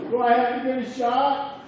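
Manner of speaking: put-on high, pleading child's voice; worried little boy. Son asks dad in the car, high anxious child voice. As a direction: whine it like a scared five-year-old.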